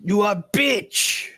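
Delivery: hammed-up Baltic bugbear insult; mock Baltic accent. Game master's bugbear answering the swashbuckler, in Baltic accent. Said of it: "bugbears in this world are fucking Baltic".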